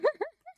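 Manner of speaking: short high squeal of laughter; high-pitched burst of laughter. Real, not acted. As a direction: giggle at your own tease. Laughing at own joke.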